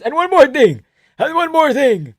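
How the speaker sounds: put-on high loud accented insistence; eager high Georgian voice; Merab impression. Merab voiced giving advice.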